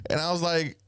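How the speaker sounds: ordinary talk, nothing marked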